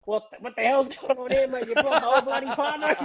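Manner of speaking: high-pitched, incredulous boss imitation; mock exasperation. Club boss finds a whole crowd turned up, incredulous. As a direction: act out a boss confronted with extra guests, amused.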